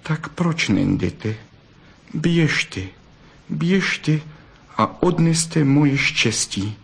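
wounded, despairing lover; sad. A narrator's reading. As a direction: plead sorrowfully as the rejected fox. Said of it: "Zadíval se na ně smutně a řekl". (smutně a zoufale)

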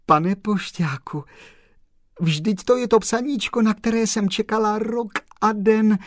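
high, loud joyful outpouring; overjoyed. Acted. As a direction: gush with happy relief. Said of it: "oči se jí zalily slzami radosti".

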